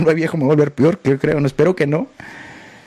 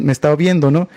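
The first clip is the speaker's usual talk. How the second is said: ordinary talk, nothing marked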